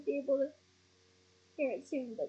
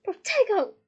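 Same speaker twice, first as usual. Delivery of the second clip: high, loud incantation; urgent spell cry; 6 semitones higher, 9 dB louder than usual. A narrator's reading. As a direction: shout the spell on instinct. Harry casts a shield spell.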